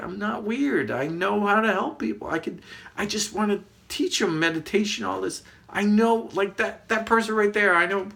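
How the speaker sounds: high-pitched eager self-defence; excited insistence. Retold or imitated. Telling companion they can help strangers, excited insistence. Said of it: "I'm so excited".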